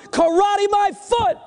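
high, slow scoff; hammed-up cynical wife; utter cynicism. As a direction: throw it back scornfully, high and drawn out. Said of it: "the wife said in utter cynicism".

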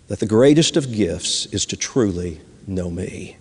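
tender God voice. Acted. God answers the weary asker in the poem, tenderly. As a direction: speak it tenderly, falling to a hush. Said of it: "tenderly said".

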